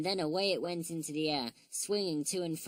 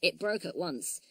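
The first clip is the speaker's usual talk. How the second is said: ordinary delivery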